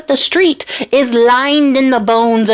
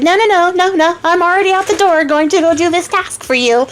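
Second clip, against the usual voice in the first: high, insistent singsong; mock-heroic protest; 8 semitones higher than usual. Charming heading out the door for the princess, mock-heroic protest. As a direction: wave off all objections in a high voice.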